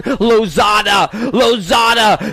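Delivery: slow, stretched-out Lozada shout; drawn-out call. Acted. Announcer hyping Lozada over the PA with a long call.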